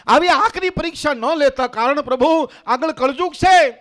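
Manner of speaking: loud, high cry to God; fervent dramatic appeal, pushed high; impassioned plea. Acted. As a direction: plead at full voice. Saints plead with God in story.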